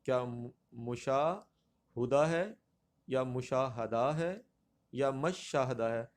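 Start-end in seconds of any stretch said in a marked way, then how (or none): 0.7-2.2 مشاہدہ slowly as "mu-shaa ... hu-da"; pronunciation option. Shown as a demonstration. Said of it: "درست تلفظ والا". 3.2-4.1 مشاہدہ as "mu-shaa-ha-da", four syllables; pronunciation option. Shown as a demonstration. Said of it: "درست تلفظ والا". 5.1-5.8 مشاہدہ as "mash-shah-da", a first; pronunciation option. Shown as a demonstration. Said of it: "درست تلفظ والا".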